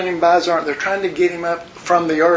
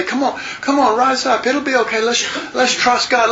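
high, pleading voice urging a grieving man up; coaxing servants; 9 semitones higher than usual. Retold or imitated. Servants urging David to rise, high and pleading. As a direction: coax him to his feet like a worried servant. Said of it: "They're trying to say".

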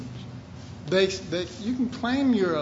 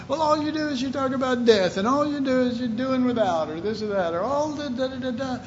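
high, fast, dismissive mock voice of a church critic; mocking denominational critic; 7 semitones higher, faster than usual. Preacher mimics a denominational churchgoer, high and mocking. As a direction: rattle it off as if brushing the whole thing aside. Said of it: "someone from a denominational church might say".

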